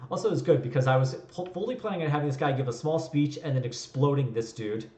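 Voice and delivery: ordinary delivery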